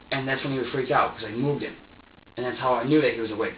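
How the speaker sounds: usual speaking voice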